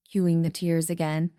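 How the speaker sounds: usual speaking voice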